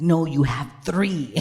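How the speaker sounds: loud, slow, emphatic insistence; acted big-sister exasperation; exasperated correction. Sister corrects clueless younger sibling.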